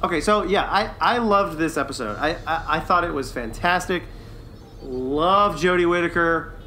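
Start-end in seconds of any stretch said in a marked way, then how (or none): none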